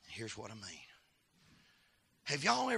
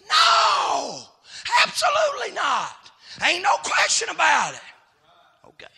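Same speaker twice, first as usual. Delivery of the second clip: loud, higher, shouting preacher cadence; fiery revival preacher calling out; preaching at full force; 12 semitones higher, 16 dB louder than usual. Acted. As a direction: hammer it home to the congregation.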